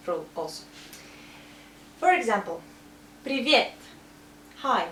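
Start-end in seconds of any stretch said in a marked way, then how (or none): 3.2-3.7 "привет" as pree-VYET, louder on the end; stressed Е. Shown as a demonstration. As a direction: punch the YE in "привет". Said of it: "This Е is stressed".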